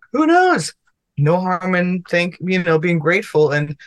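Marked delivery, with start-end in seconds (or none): 0.1-0.7 playful shrug. Real, not acted. Shrugs off whether it happens.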